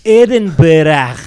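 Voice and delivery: slow, loud Scottish Edinburgh; Scottish accent. Retold or imitated. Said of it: "that's pretty good".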